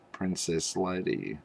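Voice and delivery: ordinary delivery, nothing marked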